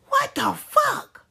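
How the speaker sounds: high, slow, stretched out what the fuck; shocked disbelief. Retold or imitated. Bystander hearing the husband, slow shocked disbelief. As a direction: draw it out in stunned disbelief. Listener's shocked reaction retold.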